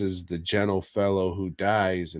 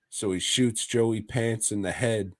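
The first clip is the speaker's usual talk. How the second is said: ordinary talk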